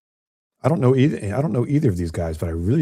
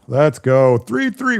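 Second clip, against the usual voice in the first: louder, rising to a high-pitched call; excited commentator's shout; excited cheer; 6 semitones higher, 5 dB louder, slower than usual. Real, not acted. Match tied at three three.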